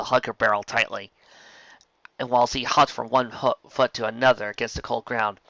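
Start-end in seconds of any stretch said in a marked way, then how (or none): none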